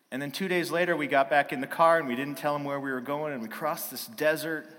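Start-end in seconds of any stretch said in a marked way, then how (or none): none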